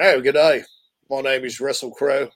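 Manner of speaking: put-on Australian accent, low and casual; Russell Crowe. Host imitates Russell Crowe talking to listeners, mock Australian accent. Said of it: "if he talked in his accent".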